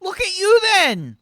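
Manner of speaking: high, loud, slow mock-stern mum; scolding mum. Mum scolding Arthur for being away, high and loud. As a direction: size him up like a nagging mother. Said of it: "she says".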